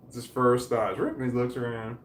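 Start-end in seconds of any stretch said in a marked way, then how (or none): 1.0-1.2 quick "ruff" flicking upward; imitated dog bark. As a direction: yip like a dog alerting to a noise. Imitates dog barking at noises.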